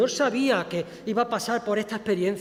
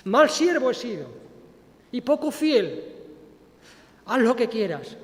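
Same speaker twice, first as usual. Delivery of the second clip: repentant confession; 4 semitones higher, 3 dB louder than usual. Acted. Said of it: "y decirle".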